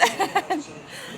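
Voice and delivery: airy giggle; soft unvoiced laugh; breathy laugh. Real, not acted. Laugh after the quote.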